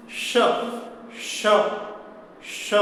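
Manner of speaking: श as a palatal sh, tongue middle lightly touching the palate; crisp isolated palatal श, repeated as a drill. Shown as a demonstration. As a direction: say श with mid-tongue near the palate, hot air hissing out. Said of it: "श बोलते हैं तो जीभ का मध्य भाग तालु".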